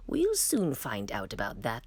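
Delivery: a lightly voiced queen planning her test; old queen's thought. A narrator's reading. The old queen thinks to herself, low and knowing. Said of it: "the old queen thought".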